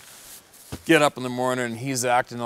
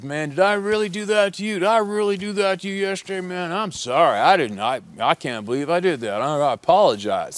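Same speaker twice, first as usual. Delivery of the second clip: much higher, louder sorry-for-himself voice; remorseful junkie; 9 semitones higher, 6 dB louder than usual. Retold or imitated. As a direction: play the strung-out guy gushing apologies. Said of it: "he goes".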